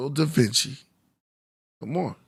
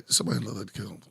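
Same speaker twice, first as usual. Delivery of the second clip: ordinary talk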